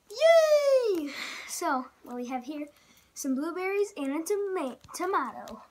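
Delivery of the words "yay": high, loud cheer; excited cheer. Real, not acted. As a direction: shout it with glee. Cheering the fruit combo.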